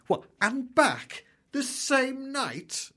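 high, drawn-out mock amazement; incredulous colleague. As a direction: squeal it slowly, imitating a flabbergasted coworker. Acts out amazed office colleague.

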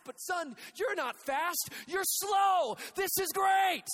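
dad shouting with joy. Retold or imitated. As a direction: yell the bad news as good news. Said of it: "My dad said".

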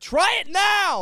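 high, loud, slow insistence; demanding insist. Retold or imitated. A date forcing her food on him, high slow demand. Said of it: "They're like".

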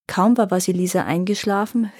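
plain reading voice

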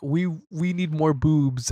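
put-on dumb teenage boy voice. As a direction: play a dopey teen boy. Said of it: "teenage boys were like".

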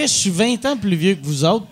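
ordinary talk

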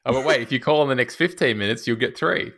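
loud, high TV pitchman; infomercial. Retold or imitated. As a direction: hype it like an ad host.

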